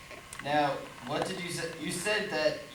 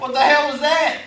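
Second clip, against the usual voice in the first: high, loud, shocked yell; startled shout; 10 semitones higher, 11 dB louder than usual. Acted. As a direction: jump at the blast. Reacts to explosion outside.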